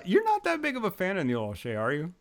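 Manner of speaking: ordinary talk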